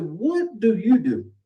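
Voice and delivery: high, slow, lofty question; a boastful guest looking down on the listener, high and slow. Retold or imitated. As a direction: ask it from way up on a pedestal. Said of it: "now he's way up here".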